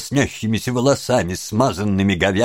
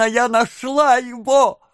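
put-on high, wailing young woman; overwrought exclamations; 9 semitones higher than usual. A narrator's reading. Young woman claiming runaway Oliver, overwrought cries. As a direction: gush and lament in a high voice. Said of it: "После этих бессвязных восклицаний".

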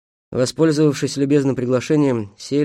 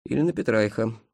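plain reading voice

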